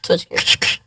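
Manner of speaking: soft unvoiced repeat; whispered echo. Real, not acted. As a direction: whisper it back. Echoing in whisper.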